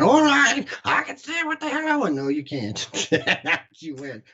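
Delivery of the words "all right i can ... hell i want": mocking old man.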